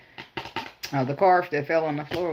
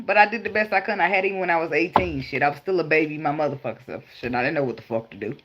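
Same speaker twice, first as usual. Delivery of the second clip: normal talking voice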